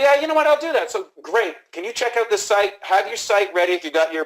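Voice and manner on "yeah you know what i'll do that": high, loud acted yes; eager prospect. Prospect agreeing to the salesman's request, acted high and loud. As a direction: play a customer readily agreeing, pitched right up.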